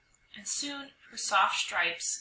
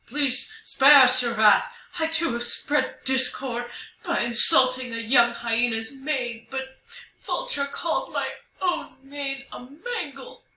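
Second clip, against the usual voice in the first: put-on voice, raised and halting; pleading Lion; 6 semitones higher, 4 dB louder than usual. Lion confessing his insult to the spirit, pleading. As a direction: beg for mercy in a character voice.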